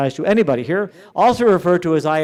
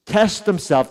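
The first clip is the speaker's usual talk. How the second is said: ordinary talk, nothing marked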